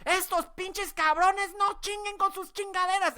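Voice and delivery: high-pitched mock rant in Spanish; exaggerated imitation of auntie cursing; cussing aunt. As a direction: parrot her swearing back at her, loud and high. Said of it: "see if this sounds familiar".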